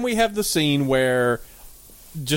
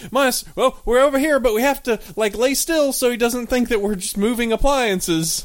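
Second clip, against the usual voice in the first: voiced appliances; 8 semitones higher than usual. Appliances whisper-calling master.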